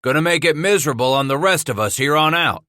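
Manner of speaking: gruff growling trapper. Acted. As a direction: growl it at him.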